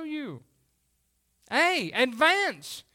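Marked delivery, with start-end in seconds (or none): none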